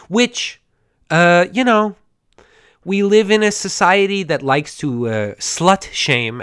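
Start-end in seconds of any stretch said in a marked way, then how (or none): none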